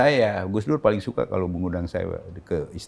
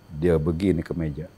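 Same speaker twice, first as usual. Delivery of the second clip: normal talking voice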